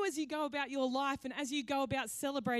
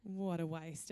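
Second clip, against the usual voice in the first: low, soft and very slow grumble of disgust; mock-sour Judas muttering under his breath; 7 semitones lower, 8 dB quieter, much slower than usual. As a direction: lower and quieter, dragging out the contempt. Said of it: "and he just says".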